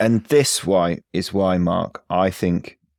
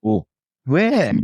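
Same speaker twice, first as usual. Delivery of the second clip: surprised; 12 semitones higher, 3 dB louder than usual. Acted. Fooled householder in the sketch.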